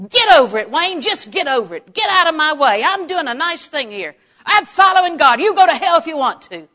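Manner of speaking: loud, high, indignant telling-off; high-horse outburst. Acted. Wife telling husband Wayne to get over it, high-horse outburst. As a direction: blast them from your high horse. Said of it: "stand on your high horse and say".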